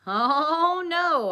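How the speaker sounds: loud, high groan of dread; dismayed. A narrator's reading. Kid hearing Dwight will investigate, loud high dismay. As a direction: cry out in exasperated dismay. Narrator dreading the Sherlock accent.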